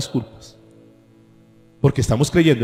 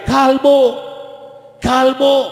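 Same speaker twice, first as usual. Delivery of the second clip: high taunting yell, much higher pitch; mocking kids' taunt; 9 semitones higher, much slower than usual. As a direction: jeer it like rude boys. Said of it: "unos muchachos comenzaron a gritarle".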